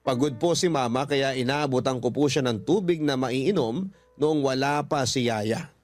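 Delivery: ordinary delivery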